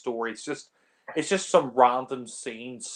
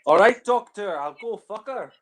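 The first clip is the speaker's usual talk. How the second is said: mock Scottish accent; 9 semitones higher, 7 dB louder than usual. Mocking film dialogue, high.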